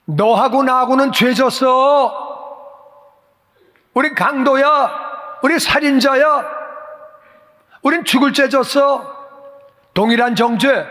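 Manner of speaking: a condemned robber shouting at his partner in crime; scolding rebuke. Acted. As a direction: bellow it down at him like a furious robber on the cross. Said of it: "다른 사람을 꾸짖는 거예요".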